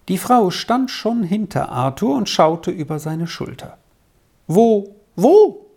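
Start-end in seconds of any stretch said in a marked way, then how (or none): 4.5-5.7 urgent, higher and louder question; eager repeated question, rising in urgency; trembling with excitement. A narrator's reading. The woman asks Arthur where her children are, agitated. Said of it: "fragte sie zitternd vor Aufregung".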